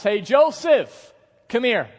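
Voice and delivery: loud, slow call across the house; commanding call. Acted. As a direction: summon him like a mistress giving orders. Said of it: "Potiphar's wife says".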